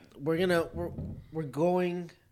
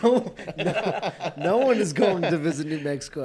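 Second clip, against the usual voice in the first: loud, high, laughing through the words; 4 semitones higher, 11 dB louder than usual. Real, not acted. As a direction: crack up while declaring it. Laughing at terrible vacation spot picture.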